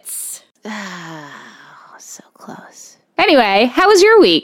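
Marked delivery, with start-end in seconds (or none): 0.1-3.0 soft unvoiced whisper; whispered regret. Real, not acted. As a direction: breathe it out in defeat. Missed the chorus line.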